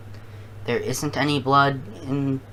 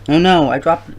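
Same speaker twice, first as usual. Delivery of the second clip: surprised; 4 semitones higher, 9 dB louder than usual. Real, not acted. Drops the jelly bean.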